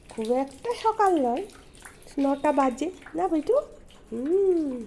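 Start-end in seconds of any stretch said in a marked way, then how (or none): none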